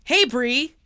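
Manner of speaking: usual speaking voice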